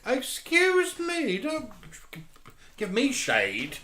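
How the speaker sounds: high, slow, sassy outrage; mock offended. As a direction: drag out the indignation.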